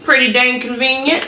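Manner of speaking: chirpy. Acted. High chirpy aside.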